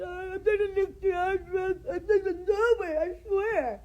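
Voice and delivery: high, loud mock-crying denial; mock tearful liar voice; mocking Christina. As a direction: whine the denial like a fake sob.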